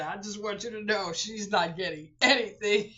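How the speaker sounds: mother's joke delivered while laughing; laughing quote. Retold or imitated. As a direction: quote her through laughter. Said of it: "She said".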